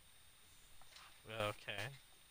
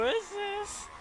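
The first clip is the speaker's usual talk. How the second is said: high, teasing question; playful taunting falsetto; 13 semitones higher, slower than usual. Pretend. Killer guessing who the player is, taunting falsetto. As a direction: ask it in a falsetto singsong.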